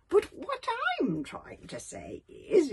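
put-on high, slow old man's voice; a frail old elder butting in. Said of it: "interrupted old Wrinkly".

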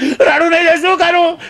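put-on high, drawn-out childish sobbing; hammed-up bawling schoolboy, slow and high; tearful wail. Pupil answering the scolding teacher, wailing in mock tears. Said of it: "છોકરો રડવા માંડ્યો".

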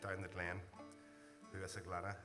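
ordinary delivery

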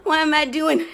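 loud, breathless laughter through the words; laughing. Real, not acted. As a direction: crack up at yourself while exercising. Laughing at herself mid-workout.

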